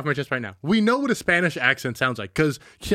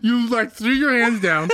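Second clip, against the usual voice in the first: laughing; 7 semitones higher, slower than usual. Real, not acted. Laughing retelling.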